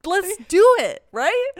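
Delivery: high, loud, frantic screaming at her friend; shrill-pitched hysterical urging; frantic yell. Retold or imitated. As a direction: yell at her to grab the card now. Still urging coworker.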